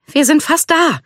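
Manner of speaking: high-pitched encouraging call. A narrator's reading. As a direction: call ahead that you're almost there, pitch way up. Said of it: "rief sie".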